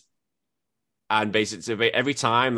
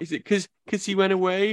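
daughter's voice, higher; 10 semitones higher than usual. Retold or imitated. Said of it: "And it was like".

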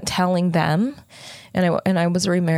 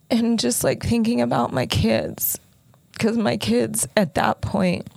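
high, slow, tearful voice; choked up; 6 semitones higher, slower than usual. Real, not acted. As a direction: voice lifted and strained by held-back tears. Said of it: "this is hard to talk about".

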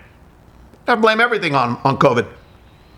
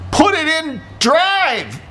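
slow; 13 semitones higher, much slower than usual. Retold or imitated. Speaking slowly to foreigner.

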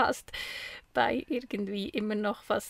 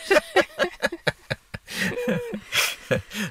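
long, high burst of laughter; 5 dB louder than usual. Real, not acted. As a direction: laugh out loud at the joke. Laughs at "noch sexier.

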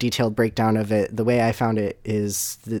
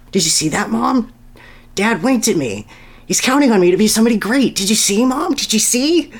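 put-on high, bright-eyed kid voice, much higher and louder; young hedgehog telling his mom his dad winked, excited and high; excited Sonny; 10 semitones higher, 6 dB louder than usual. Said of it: "jumping up and down excitedly".

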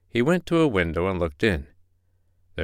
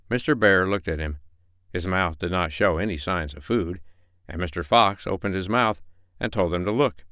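plain reading voice

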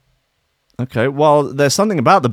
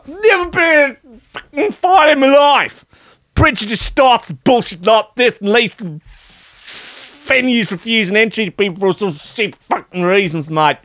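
high-pitched, hammed-up Australian punk tirade; mock-outraged Australian holler; scathing Aussie rant; 11 semitones higher than usual. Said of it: "wrote a scathing message on Facebook".

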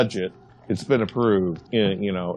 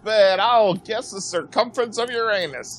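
high, put-on barker's call; carnival barker; 12 semitones higher than usual. Co-host joining in with a barker's call. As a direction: hawk it like a sideshow barker. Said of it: "you sounded like a carnival barker".

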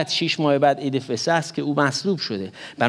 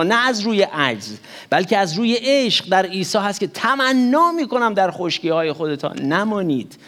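usual speaking voice

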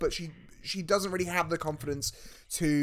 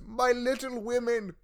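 slow, higher fatherly coo; doting father voice; 7 semitones higher, slower than usual. Retold or imitated. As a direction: croon it like a proud dad. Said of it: "where he comes and goes".

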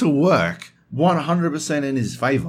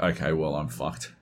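softer, deflated "I'm fucked"; resigned mutter; 9 semitones lower, 7 dB quieter than usual. Retold or imitated. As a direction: give up under your breath. Resigned inner thought.

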